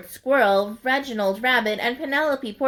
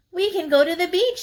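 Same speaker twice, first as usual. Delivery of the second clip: 1 eager rabbit; 9 semitones higher, faster than usual. Acted. Rabbit excited about beach.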